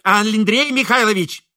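loud, high female voice; woman shouting. A narrator's reading. Wife calling to her husband from the room, shouting. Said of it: "закричал женский голос из комнаты".

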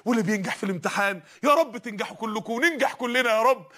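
normal talking voice

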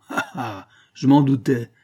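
scornful jeer, rising high and loud; sneering. A narrator's reading. Jealous husband who found the lover's letter, jeering sneer. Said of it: "reprit-il en ricanant".

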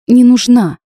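defiant, pushed-up no; stubborn. A narrator's reading. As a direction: dig in and refuse. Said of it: "упрямо произнесла я".

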